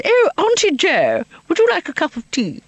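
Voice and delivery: put-on high-pitched posh girl's stage voice; posh Cynthia. Auditioning for Cynthia among posh girls, high posh accent. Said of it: "these very posh girls".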